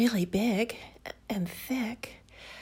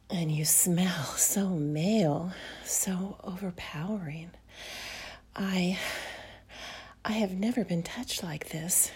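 sultry whisper; 4 dB quieter than usual. Acted. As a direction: whisper close and seductive. Whispers intimately throughout.

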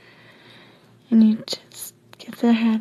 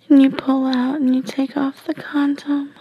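ordinary delivery, nothing marked

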